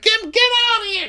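very high squeaky yelling voice; Fatum yelling. Retold or imitated. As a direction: shriek it at the door.

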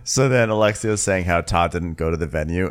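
normal talking voice

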